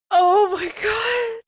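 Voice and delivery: high, slow, shouted oh my God; shouted disbelief. Real, not acted. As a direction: yell it out in total disbelief. Disbelief at the absurd fanfic text.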